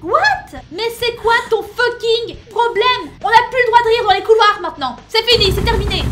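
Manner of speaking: loud outraged rant; indignant shouting. Acted. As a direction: yell back in mock outrage. Indignant outburst at senpai.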